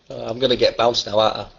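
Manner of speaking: usual speaking voice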